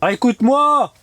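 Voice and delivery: high, loud call. Real, not acted. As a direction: shout to a friend. Calling out to friend. (appel fort)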